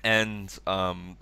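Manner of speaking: ordinary talk, nothing marked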